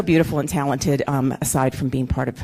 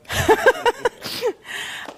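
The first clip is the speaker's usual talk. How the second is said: a burst of high giddy laughter; high delighted laugh. Real, not acted. Speaker laughing as her husband joins her on stage, high giddy laugh. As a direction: laugh high and bright as he comes up.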